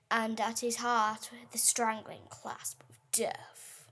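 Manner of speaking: reading a war poem with mounting dread; dramatic poem reading. Acted. Child reading a war poem to her dad, dramatic recital. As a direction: build the horror line by line.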